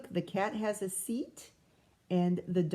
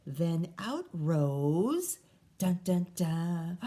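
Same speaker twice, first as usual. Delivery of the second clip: dramatic suspense; 4 semitones lower, slower than usual. Acted. Suspense build over cauldron.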